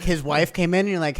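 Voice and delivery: ordinary talk, nothing marked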